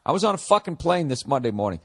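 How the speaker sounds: ordinary delivery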